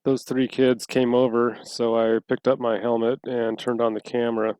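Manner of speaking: muffled, heard through a helmet; recorded through a helmet mic. Real, not acted. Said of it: "so it's still recording through the, mic inside the helmet".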